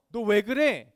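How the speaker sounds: loud, indignant retort; acted angry comeback. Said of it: "화를 내면서".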